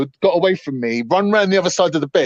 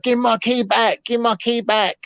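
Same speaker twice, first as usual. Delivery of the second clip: higher, slow mocking demand; demanding girlfriend; 6 semitones higher, slower than usual. Girlfriend demanding her key, voiced higher and slow. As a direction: stretch out her nagging. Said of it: "She's like".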